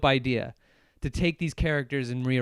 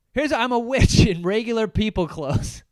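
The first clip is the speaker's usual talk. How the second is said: mocking lazy partygoer; 8 semitones higher, 8 dB louder than usual. Mocking lazy costume wearers.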